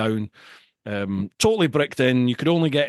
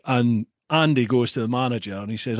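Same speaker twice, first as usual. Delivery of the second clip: normal talking voice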